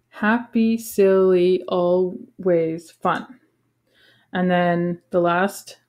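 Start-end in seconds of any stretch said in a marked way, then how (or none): 0.1-3.4 poem reading. Shown as a demonstration. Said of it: "second line has seven syllables".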